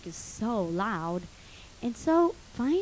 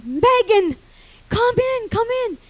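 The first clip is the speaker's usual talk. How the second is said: high, louder beckoning; urgent calling; 10 semitones higher, 6 dB louder than usual. Retold or imitated. Trapped woman calling Megan to open the sauna door, urgent. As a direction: call them in urgently. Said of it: "I go".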